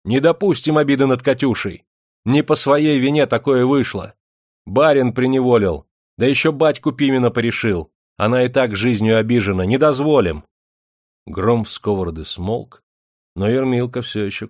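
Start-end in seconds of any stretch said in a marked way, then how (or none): 0.0-10.4 loud, high angry protest; angry shouting. Acted. Village women shout angrily in Katyusha's defence. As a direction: defend her loudly. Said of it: "сердито закричали".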